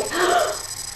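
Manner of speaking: quick high gasp of realising; sharp gasp. Retold or imitated. About to answer the boss, a quick gasp.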